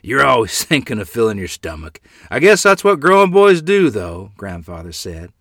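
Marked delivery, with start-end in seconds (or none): 0.0-4.3 chuckling, then loud and high; laughingly. A narrator's reading. Grandfather teases a hungry grandchild, laughingly. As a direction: tease through a laugh, voice lifting.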